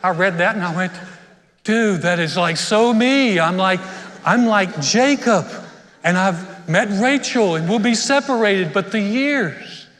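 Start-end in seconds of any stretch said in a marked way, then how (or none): 1.6-9.9 loud, high, drawn-out gushing; excited realization. Retold or imitated. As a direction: belt it out like a lovestruck discovery. Acting out his excited younger self.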